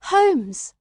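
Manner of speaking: loud astonished cry of a name. A narrator's reading. Watson crying out on seeing Holmes, loud astonished cry. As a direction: call his name out in shock. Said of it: "I cried".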